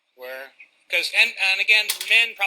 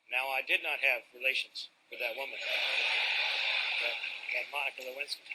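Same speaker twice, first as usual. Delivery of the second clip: soft, low presidential denial; a lightly voiced hushed president; Bill Clinton; 3 semitones lower, 7 dB quieter than usual. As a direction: deny it slowly and quietly like a politician. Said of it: "he would speak like this".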